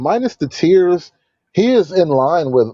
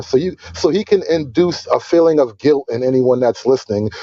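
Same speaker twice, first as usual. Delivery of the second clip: usual speaking voice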